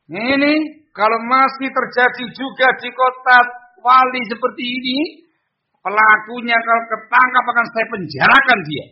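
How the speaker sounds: high, loud official warning; acted authoritative angry ultimatum; threatening. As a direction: threaten them as a stern ruler. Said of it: "Ada unsur ancaman".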